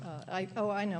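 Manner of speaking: ordinary talk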